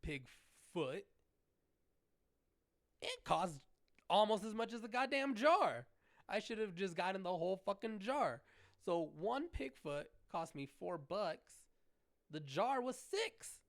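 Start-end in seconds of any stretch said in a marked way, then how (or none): 3.0-13.6 indignant rant. Real, not acted. Ranting about the price.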